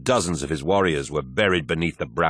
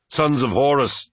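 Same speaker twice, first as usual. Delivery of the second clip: a loud, higher call into the wreck; calling shout; 7 semitones higher, 4 dB louder than usual. A narrator's reading. War Master calling for his lost warriors, shouting. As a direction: shout out to find your men. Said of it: "he shouted".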